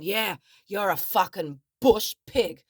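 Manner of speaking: slow, loud mock sneering abuse; aggressive insult. Man towering over the woman he is insulting, imitated loud aggression. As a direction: imitate him, leaning hard on each word.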